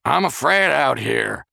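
scared yell through a door; high, slow, loud call. A narrator's reading. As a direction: shout it out, frightened. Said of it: "he was evidently yelling loud".

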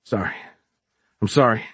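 short gasped apology; a touch out of breath, apologetic. A narrator's reading. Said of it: "Giles gasped".

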